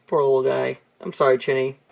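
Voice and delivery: ordinary delivery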